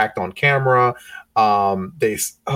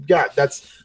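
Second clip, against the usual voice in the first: ordinary talk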